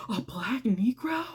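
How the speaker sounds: hushed, breathy aside as if scandalized; mock whisper. [name] repeating the landlord's words in a mock-scandalized whisper. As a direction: whisper it like a shocked secret.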